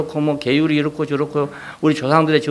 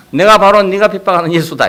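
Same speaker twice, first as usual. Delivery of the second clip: declaring voice of Jesus; 5 semitones higher, 6 dB louder than usual. Acted. Preacher voices Jesus declaring himself.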